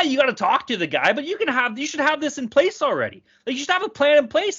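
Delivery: ordinary delivery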